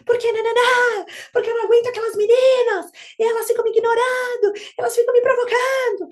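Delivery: put-on high, loud whiny child complaining about the girls; whiny nine-year-old daughter. Mother mimics daughter complaining about mean girls, high loud whine. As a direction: play the upset daughter venting, high and loud. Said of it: "A minha filha de nove anos fica".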